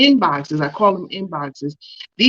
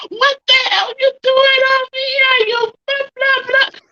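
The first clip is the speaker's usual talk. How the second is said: high-pitched mock New York outburst; New York accent. Friend imagining her cursing at drivers, New York accent. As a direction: put on an irate New Yorker, way up high. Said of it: "like, the New York coming out".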